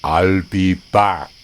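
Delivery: put-on very slow, low, heavy German accent; big German guy's parting threat, slow and ominous. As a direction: drag out each word like the Terminator. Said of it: "he said".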